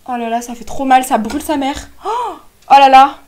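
loud pained moaning; pained cry. Real, not acted. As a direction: wail at the burning sting. Lip plumper burning her lips.

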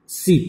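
"sip" said crisply with a hissed s; sip sound. Shown as a demonstration. Teacher demonstrating "sip" to the class. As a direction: model "sip" with a sharp s. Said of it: "This one is so".

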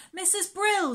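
high, loud call to the next room; girl calling the housekeeper from another room. Acted. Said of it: "she called".

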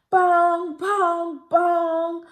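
loud booming clock strikes; clock bongs. A narrator's reading. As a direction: boom like a big clock.

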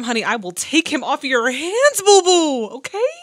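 playful sassy offer; sassy flirt. Real, not acted. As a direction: tease like you're stealing her man. Flirting with the nun's suitor.

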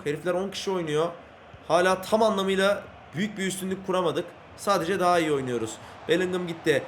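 normal talking voice

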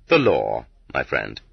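low, dry ironic aside; sardonic echo. A narrator's reading. Narrator mocking the court's threat, low and dry. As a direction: drop low and let the irony sit.

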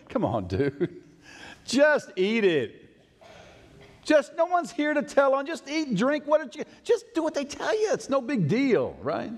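high, coaxing peer-pressure voice; pushy friends. Acted. Friends pressuring Daniel in a high coaxing voice. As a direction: goad him up high, teasing. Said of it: "I just see the friends going".

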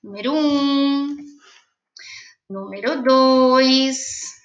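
slow, spaced dictation numbers; drawn out, each number held. Shown as a demonstration. Teacher dictating to pupils, slow and held. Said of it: "Então, põe".

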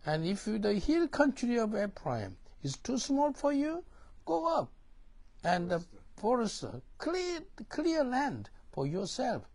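Joshua's answer. Retold or imitated. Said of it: "Joshua answered".

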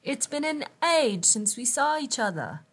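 put-on loud, high, gushing English accent; fake English accent. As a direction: effuse like a stage star faking English. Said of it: "in a fake English accent".

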